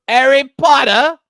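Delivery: put-on high, loud Monty Python British accent; condescending British accent. Podcast guest mimicking the host saying a film name, condescending British accent. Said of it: "condescending Monty Python British accent".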